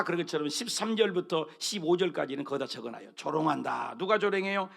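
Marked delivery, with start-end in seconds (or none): none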